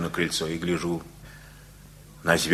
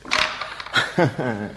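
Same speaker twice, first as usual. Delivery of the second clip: warm chuckle. Acted. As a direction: laugh off the defeat. Laughs after losing game. (добродушный смешок)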